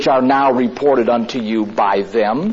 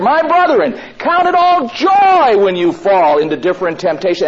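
loud proclaiming; 7 semitones higher, 4 dB louder than usual. Retold or imitated. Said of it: "He's saying here".

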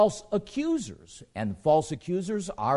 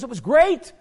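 excited; 7 semitones higher, 9 dB louder than usual. Retold or imitated. Jose raving about America.

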